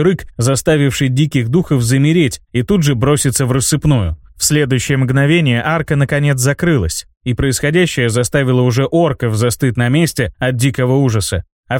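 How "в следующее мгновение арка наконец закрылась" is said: plain reading voice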